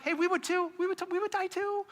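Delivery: high, hurried chiming in; sheepishly joining the bold promise; eager disciples. Retold or imitated. Disciples hastily echoing Peter's vow, high eager voice. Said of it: "I think they're all like".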